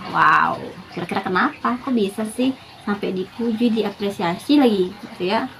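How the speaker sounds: usual speaking voice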